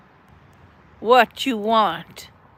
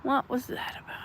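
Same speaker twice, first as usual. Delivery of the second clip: unvoiced whispered question; hushed whisper; 3 semitones higher, 11 dB quieter, faster than usual. Real, not acted. Repeats line in whisper.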